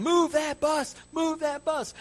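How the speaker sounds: high, loud TV crowd chant; imitated crowd's rallying yell; crowd shouting. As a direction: shout it like an excited studio crowd. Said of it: "But they're shouting".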